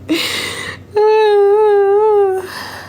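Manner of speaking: a high, loud crying wail; wailing sob of relief; loud relieved sob. Retold or imitated. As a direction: let out a sobbing cry of relief. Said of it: "I'm crying".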